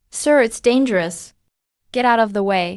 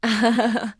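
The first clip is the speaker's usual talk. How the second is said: short laugh. Acted. Laugh alone on a line.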